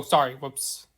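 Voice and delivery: ordinary talk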